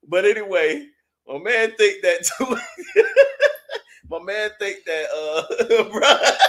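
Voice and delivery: high, loud, breaking into laughter; can't hold the laugh in; cracking up. Real, not acted. Reading a funny chat comment, laughing hard.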